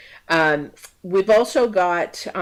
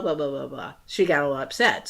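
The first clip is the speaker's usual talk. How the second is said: ordinary delivery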